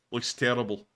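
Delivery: normal talking voice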